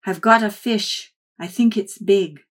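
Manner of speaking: a fisherman thrilled at his catch. Acted. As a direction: grandfather calling out his big catch, thrilled. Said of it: "He was thrilled".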